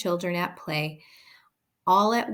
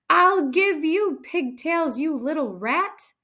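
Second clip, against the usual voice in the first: yelling; 10 semitones higher, 5 dB louder than usual. A narrator's reading. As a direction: roar while swinging the girl. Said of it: "the Trunchbull was yelling".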